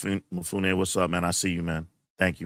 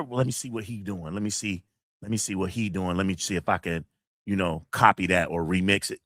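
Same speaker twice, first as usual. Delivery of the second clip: mock copycat musing; 8 semitones lower than usual. Mocking a copycat's thoughts.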